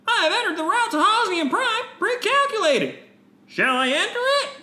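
high, sing-song polite droid. Acted. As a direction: chirp it like a helpful robot. Droid voice continues.

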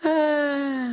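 a high, put-on "אה" grunt; a mock noncommittal "אה"; wordless grunt. Rabbi answers the shouting man with a high mock grunt. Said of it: "ואני אמרתי לו".

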